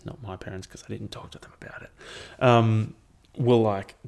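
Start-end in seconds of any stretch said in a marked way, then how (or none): 0.0-1.9 whispered aside. Real, not acted.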